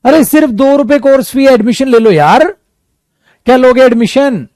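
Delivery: ordinary talk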